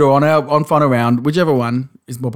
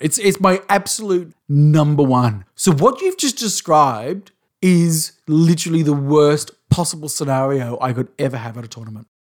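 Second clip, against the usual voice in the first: ordinary talk, nothing marked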